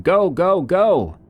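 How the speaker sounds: loud, high-pitched urging cry; urgent shout. A narrator's reading. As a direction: shout it like you're urging friends to run. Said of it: "Sean was shouting something like".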